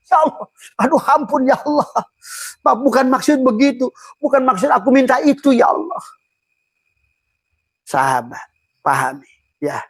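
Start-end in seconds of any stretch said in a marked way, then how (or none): 0.1-6.0 high, pleading mock-tearful plea to God; tearful prayer. A saint answered by God, voicing a mildly tearful plea. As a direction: act out the saint's teary apology. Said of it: "diijabah malah nangis".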